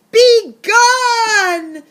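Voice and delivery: high, loud roaring command. Acted. Bear roars at the mouse in the fridge, loud roar. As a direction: roar him out of the house. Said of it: "roared the bear".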